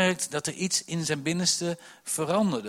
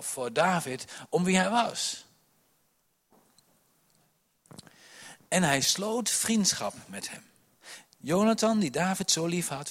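full-force preaching; 2 semitones higher, 5 dB louder than usual. Acted. Preacher reading Samuel to the congregation, loud and fervent. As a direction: proclaim the Bible story to the room.

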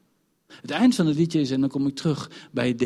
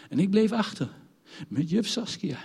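ordinary delivery

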